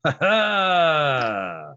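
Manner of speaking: loud, high victorious ha ha; triumphant laugh. Acted. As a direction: crow with delight.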